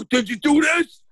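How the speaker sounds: loud, high interrogation; heated. Retold or imitated. Dad grilling his kid on assignments, heated. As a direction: re-enact a heated dad demanding an answer. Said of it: "getting fucking more and more heated".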